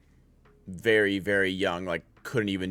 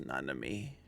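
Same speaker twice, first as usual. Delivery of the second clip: quoting Yuji softly; 9 semitones lower, 13 dB quieter than usual. Retold or imitated. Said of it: "when he goes".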